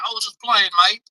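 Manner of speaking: a clumsy stab at a British mate; British accent. Retold or imitated. Imitating a British friend in a poor British accent. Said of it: "That was a horrible impression".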